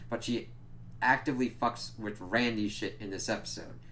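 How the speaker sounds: usual speaking voice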